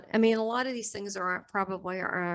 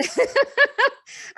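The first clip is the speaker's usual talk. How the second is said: high loud laugh; 9 dB louder than usual. Real, not acted. Sharing the Jesus joke with the host, loud laugh. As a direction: burst of high cackling laughter.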